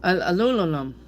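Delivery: "aluminum" tumbling into "alulolum"; tongue-tied. Shown as a demonstration. Reader failing at a word that won't roll, tongue-tied. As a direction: trip over the n and m in aluminum. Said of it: "it just doesn't roll with me".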